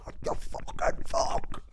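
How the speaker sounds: strained, voiceless yell; hoarse mock screech. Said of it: "just screamed in his face".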